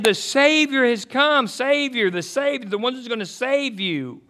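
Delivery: fervent sermon climax; preaching at full force. Acted. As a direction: proclaim the good news to the crowd.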